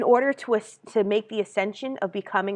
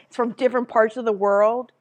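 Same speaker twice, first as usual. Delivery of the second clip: ordinary talk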